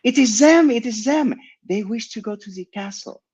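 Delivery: put-on shrieked announcement in a French accent; calling out loudly. A narrator's reading. Girl calls ahead about the visitors, high French-accented shout. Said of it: "she called out loudly".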